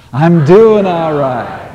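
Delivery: loud, very slow emphatic affirmation; said with conviction. Shown as a demonstration. Second chance for the congregation, slow loud proclamation. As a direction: shout it out and mean every word. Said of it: "give another chance here".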